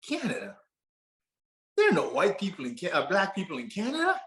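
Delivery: high, quick, disbelieving Southern voice; incredulous Southern accent. Acted. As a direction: imitate a stunned Southerner, rising to the question. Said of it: "attempt at my best Southern accent".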